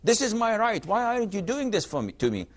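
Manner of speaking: a hint of the entitled husband; imitating a demanding husband. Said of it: "They know their rights".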